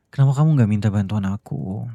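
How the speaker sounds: frustrated. Acted. Husband frustrated with wife's silence.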